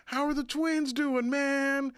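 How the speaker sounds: high, bright-pitched imitation of a casual passerby; tossed-off friendly greeting, voiced way up; breezy celebrity hello; mock music legend. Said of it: "they're like".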